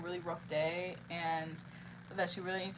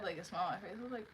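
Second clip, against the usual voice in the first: ordinary talk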